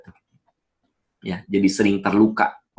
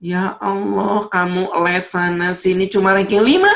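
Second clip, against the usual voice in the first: much higher, louder disbelieving scold; mother scoffing at a child's fifth place; shocked mother; 12 semitones higher, 5 dB louder than usual. Retold or imitated. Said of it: "Ibunya meni- gampang ngomong".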